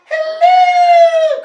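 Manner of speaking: very high, loud, hammed-up hoot; queen's cry. A narrator's reading. Queen greeting the children, high loud cry. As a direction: trill it out like a grand queen. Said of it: "cried the queen".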